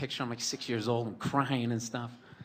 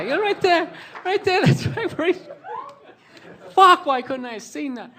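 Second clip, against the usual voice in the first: very high, loud shrieking through laughter; gleeful kid squealing with laughter; hysterical laughing; 15 dB louder than usual. Retold or imitated. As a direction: crack up as you shout it. Acts brothers laughing at father's fall.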